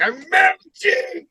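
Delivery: high, slow, warbling melt; melting victim. Acted. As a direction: stretch it out high like a melting monster. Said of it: "all of a sudden, like".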